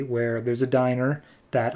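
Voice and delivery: plain reading voice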